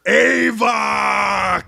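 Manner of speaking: long, high, full-force scream of the name; scream at the top of his lungs. Acted. Creature raising the hero's arm, screaming to the crowd. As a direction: roar it out to rally everyone. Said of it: "just screams at the top of his lungs".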